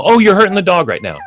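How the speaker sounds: mock-alarmed, high-pitched protest; alarmed objection. Quoting alarmed objecting dog owners.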